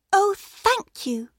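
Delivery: put-on high, slow girlish thanks; sweet small child voice; little girl voice. A narrator's reading. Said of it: "said the little girl".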